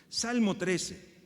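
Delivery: loud, slow, drawn-out announcement. Acted. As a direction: announce the psalm like a proclamation. Announces the psalm.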